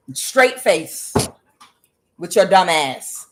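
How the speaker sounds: usual speaking voice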